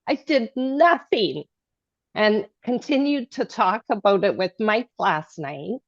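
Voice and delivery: ordinary talk, nothing marked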